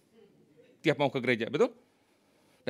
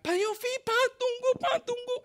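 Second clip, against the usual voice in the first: very high, thin-volume mock yell; imitated calling out. Woman calls after pastor on the roadside, mock shouting. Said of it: "Ada satu ibu teriak-teriak".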